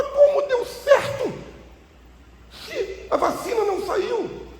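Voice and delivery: very high, slow, laughing disbelief; incredulous protest. Retold or imitated. Pastor protesting to God's voice, high and laughing in disbelief. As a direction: squeal it out in amused disbelief.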